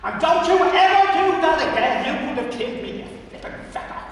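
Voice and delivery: angry scolding. Acted. Furious old man scolding. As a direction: Pat berating Michael for letting go. Pat scolding after near fall.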